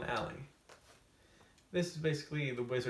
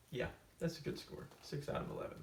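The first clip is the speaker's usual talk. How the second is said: smug whispered aside; 2 semitones higher, 6 dB quieter, faster than usual. Acted. Smug aside on silly score.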